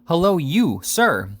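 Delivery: loud, high hail; Sam's third call to the stranger, loud shout. A narrator's reading. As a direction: call out sharply a third time. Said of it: "another shout".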